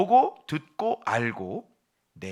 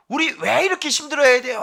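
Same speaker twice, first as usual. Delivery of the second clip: much higher and louder, an aching plea; pleading cry; 12 semitones higher, 8 dB louder than usual. Acted. Sufferer asking the Lord why, loud pleading cry. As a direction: cry out the complaint. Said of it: "하는데 그 질문을 들으면".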